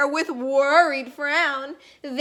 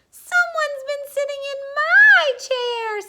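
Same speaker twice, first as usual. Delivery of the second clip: Mama Bear; 12 semitones higher, 5 dB louder than usual. A narrator's reading. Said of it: "said Mama Bear".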